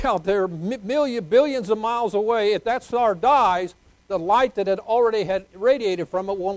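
loud, high-pitched preacher's wonder; full-force preaching. Real, not acted.

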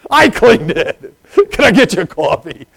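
high, loud, drawn-out mock boast; proud announcement. Toilet cleaner claiming credit to the visitor, proudly loud. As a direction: burst out with comic pride, loud and high. Acting out his proud eager reply.

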